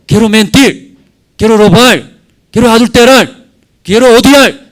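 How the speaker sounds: loud, high, grasping voice of the flesh; preacher voicing the body's cravings, loud and grasping; greedy flesh voice. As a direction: let the flesh demand its sins, loud and high. Flesh voiced demanding sins.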